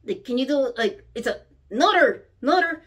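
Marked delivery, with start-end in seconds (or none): none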